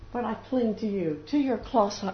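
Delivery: usual speaking voice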